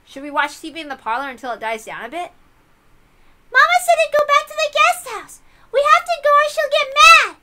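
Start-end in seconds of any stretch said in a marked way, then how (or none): 3.5-7.4 put-on high, loud little girl pleading; a small girl repeating what Mama told her; high childish urging; insistent child voice. Child insists on going back.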